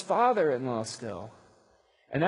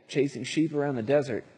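normal talking voice

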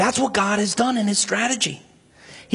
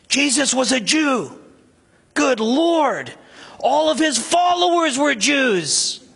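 higher, intense preaching with rising indignation; impassioned outrage; 6 semitones higher than usual. Real, not acted. Preacher condemning antisemitism in the church, impassioned and outraged. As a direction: rebuke the congregation with burning conviction.

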